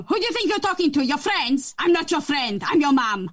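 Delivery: mock-stern Portuguese-accented mum; mum's scary accent. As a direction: imitate a Portuguese mum scolding, accent and all. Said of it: "something my mum used to say".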